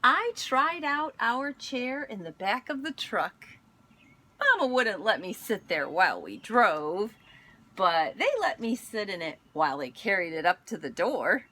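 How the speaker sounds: gentle storybook read-aloud; picture book reading voice; reading to children. Acted. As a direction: read it like story time for kids.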